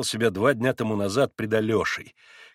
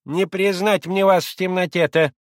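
put-on high, louder old woman's voice; old servant woman; 7 semitones higher, 6 dB louder than usual. A narrator's reading. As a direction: squint in the dark as an old maid.

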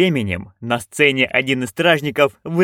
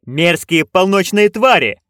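slow, higher barked answer; 5 semitones higher, 4 dB louder than usual. A narrator's reading. Witches barking at their director, slow and louder. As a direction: bark it back, proud and slow. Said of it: "рявкнули они".

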